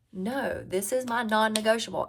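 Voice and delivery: ordinary talk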